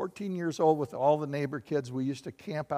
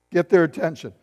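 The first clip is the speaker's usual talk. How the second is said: normal talking voice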